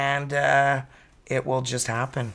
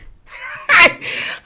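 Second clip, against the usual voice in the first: bright amused laugh; high laugh; 8 dB louder than usual. Real, not acted. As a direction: laugh at yourself. Laughs at herself.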